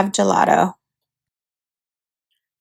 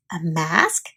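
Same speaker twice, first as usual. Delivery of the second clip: much lower and softer; quiet wondering; 6 semitones lower, 9 dB quieter than usual. Acted. Olivia browsing souvenirs, quiet musing. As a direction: muse to herself.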